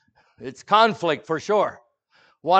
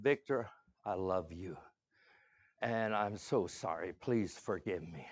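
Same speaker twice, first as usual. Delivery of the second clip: soft, sincere plea for forgiveness; a much softer, heartfelt sorry; tender apology; 6 semitones lower, 12 dB quieter than usual. Retold or imitated. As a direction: say it softly, arm around his shoulders.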